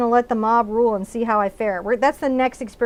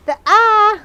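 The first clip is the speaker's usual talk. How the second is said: "The eye" much higher and louder, hammed-up; big theatrical "The eye", pitched high; dramatic emphasis; 10 semitones higher, 9 dB louder than usual. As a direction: announce "The eye" like a grand reveal. Repeats favorite part dramatically.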